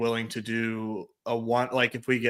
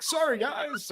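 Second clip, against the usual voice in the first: mock apology; 11 semitones higher, 5 dB quieter, slower than usual. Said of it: "leave a comment like".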